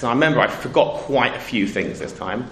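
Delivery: usual speaking voice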